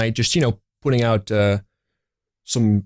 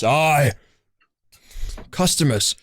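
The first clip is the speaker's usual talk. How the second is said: dwarven voice; 3 semitones higher, slower than usual. Acted. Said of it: "this dwarven voice".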